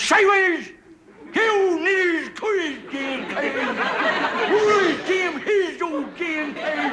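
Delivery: put-on high, slow, mushy old prospector mumble; cackling Gabby Hayes mumble, words all slurred; mumbling old-timer. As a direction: garble it like a toothless old miner. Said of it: "He goes".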